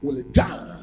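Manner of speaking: high, loud, rising sermon voice; impassioned pulpit cry; fiery preaching. Acted. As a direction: preach it hard to the congregation. Preaching at full force.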